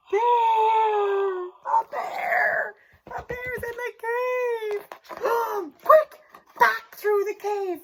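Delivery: high, loud mock panic; panicked cry. Teacher discovering the bear with the kids, panicking. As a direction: shriek it as if the bear is right there.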